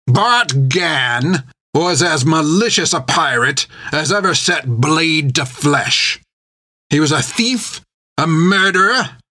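put-on pirate captain taunting the old nun; menacing pirate. A narrator's reading. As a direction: savour every insult like a cruel captain. Creech voiced in the story.

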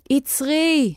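high cry, volume held back; shouted command. A narrator's reading. Wizard yelling at the hen to stop, understated shout. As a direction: cry out high to halt someone. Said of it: "צעק".

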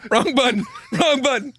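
laughing through the words. Real, not acted. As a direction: blurt it out while laughing. Laughing after wrong drop.

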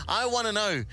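usual speaking voice

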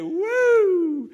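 long, loud high-pitched "woo"; joyful whoop. Acted. Thirsty people hearing of water, joyful whoop. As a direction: let out a big celebrating whoop. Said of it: "I can imagine they".

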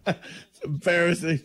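laughing through the words; cracking up mid-sentence; helpless giggle. Real, not acted. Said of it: "laughing at the impression".

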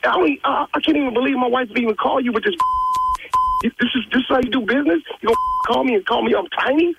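high, heated, fast indignant rant; furious. Real, not acted. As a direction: blow up at the insult.

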